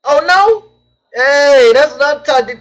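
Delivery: high, loud burst of excitement; excited reaction. Real, not acted. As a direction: pop up in delighted surprise. Reacting to a viewer comment.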